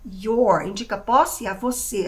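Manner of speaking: ordinary delivery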